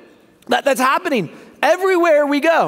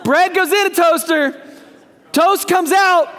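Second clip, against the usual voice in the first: exasperated shout; 6 semitones higher than usual. Real, not acted. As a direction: shout it like it's obvious. Exasperated at wrong answer.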